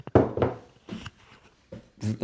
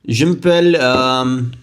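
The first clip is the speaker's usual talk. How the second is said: French accent; slower than usual. Shown as a demonstration. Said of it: "I can't even do a French accent".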